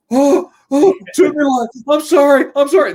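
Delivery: high, loud flustered apology; embarrassed boys apologizing. Retold or imitated. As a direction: squeak out a panicked sorry like a caught kid. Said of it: "They were apolo".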